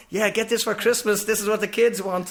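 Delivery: retold presenter's hard sell; TV sales pitch. Acting out his cheery TV shopping pitch.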